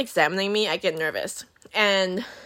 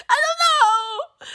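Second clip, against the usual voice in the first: very high, slow squealed cry; hammed-up helpless wailing; mock tearful wail; much slower than usual. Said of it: "still crying at this point".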